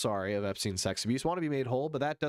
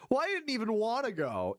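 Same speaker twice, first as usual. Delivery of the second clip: sulky Elon; 9 semitones higher than usual. Retold or imitated. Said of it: "for him to go".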